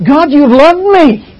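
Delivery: high, loud, slow and lifted; the same verse read with excitement; excited reading. Shown as a demonstration. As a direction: lift it up with joy. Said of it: "I'm excited about it".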